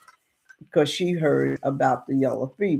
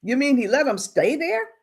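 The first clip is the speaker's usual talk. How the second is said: higher-pitched nervous questioning; acted nervousness; 9 semitones higher than usual. Townsman questions a boy about the sick settlers, acted nervousness. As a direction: question it in mock disbelief, rising at the end. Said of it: "Mister Bass is getting nervous".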